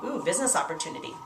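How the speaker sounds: soft, quick, interested "Ooh, business opportunity"; intrigued inner thought. Retold or imitated. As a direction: murmur it like a guest whose interest is caught. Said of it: "So they start thinking".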